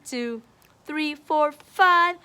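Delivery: usual speaking voice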